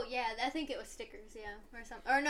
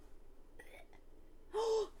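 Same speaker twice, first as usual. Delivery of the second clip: whispered gasp of recognition; 8 semitones higher, 5 dB quieter than usual. Real, not acted. Remembering the program.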